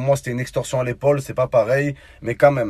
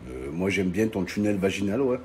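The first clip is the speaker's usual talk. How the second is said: laughing; 5 semitones lower, 5 dB quieter than usual. Real, not acted. Laughing reply.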